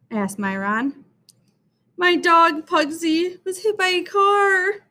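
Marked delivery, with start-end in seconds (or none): 2.0-4.9 put-on high, upset child's wail; crying out. A narrator's reading. Dana telling Myron about her dog, tearful cry. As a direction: cry it out in a raised, distressed voice. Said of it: "Dana cried".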